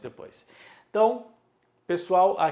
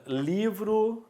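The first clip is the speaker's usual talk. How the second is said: LIVRO slow and high, LI stretched; stressed penultimate syllable; 6 semitones higher than usual. Shown as a demonstration. Teacher naming things around the room, stress on LI in "livro". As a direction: draw out the LI in "livro". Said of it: "paroxítona".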